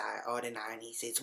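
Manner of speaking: normal talking voice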